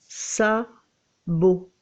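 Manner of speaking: SABOT split in two, "sa", pause, "bo"; syllable by syllable. Shown as a demonstration. Teacher splits word into syllables.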